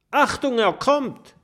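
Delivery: hoch und laut warnen; warnender Ruf. Acted. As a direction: Alarm rufen. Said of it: "ruft Kokosnuss". (Kokosnuss warnt seine Freunde, laut und hoch gerufen)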